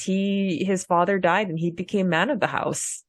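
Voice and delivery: usual speaking voice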